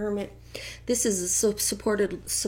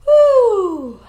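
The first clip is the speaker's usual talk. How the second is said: loud whooping shout; excited cheer; 8 semitones higher, 11 dB louder, much slower than usual. Real, not acted. Tarot reader whooping at her viewers, loud cheer. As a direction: let out a big woo.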